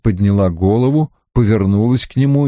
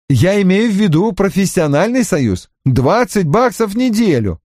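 higher-pitched, mildly outraged; clarify hastily, a touch scandalised; indignant clarification; 8 semitones higher than usual. Acted. Narrator's hasty, indignant clarification. (слегка возмущённо, поясняя)

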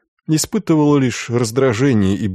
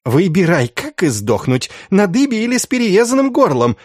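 high, goading threat; menacing encouragement; 9 semitones higher than usual. A narrator's reading. Institoris makes the prisoner choose his death, high goading threat. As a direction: goad him cruelly in a high voice. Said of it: "подбодрил Инститорис".

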